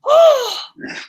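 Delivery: sharp, high gasp of joy; delighted gasp. Real, not acted. Teacher sees student's face.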